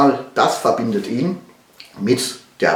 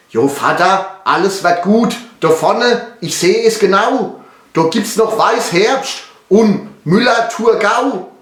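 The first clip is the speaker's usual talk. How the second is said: higher, eagerly reassuring son's voice; encouraging son; 9 semitones higher, 3 dB louder than usual. Acted. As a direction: cheer your father on in Pfälzisch. (aufmunternder Sohn, höhere Stimme)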